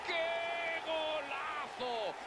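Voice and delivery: screaming goal call, high pitched; breakneck goal commentary. Acted. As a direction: stretch out the goal cry. Goal scored, commentator erupts.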